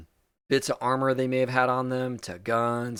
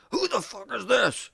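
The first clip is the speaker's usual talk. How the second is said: pissed; 11 semitones higher than usual. Acted. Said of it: "he's like pissed looking".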